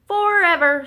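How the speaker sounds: high, loud joyful announcement; happily. A narrator's reading. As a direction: burst out with happiness. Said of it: "said Duck happily".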